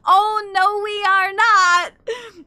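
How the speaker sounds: loud, high, drawn-out mock lament; mock wail. Streamer seeing the timer under one hour, mock wail. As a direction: wail it in exaggerated dismay.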